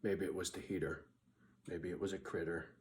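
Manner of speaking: murmured low and soft, half to himself; hushed inner thought. Retold or imitated. Lying in bed at night, guessing at the noise, hushed. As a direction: softly wonder what the noise was. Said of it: "I'm like".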